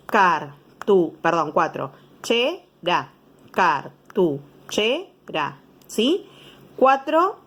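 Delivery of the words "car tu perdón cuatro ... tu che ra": car, tu, che, ra, each syllable said apart; beating syllables. Shown as a demonstration. Teacher to pupils, counting beats, one syllable each. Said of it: "tengo tres golpes de letras".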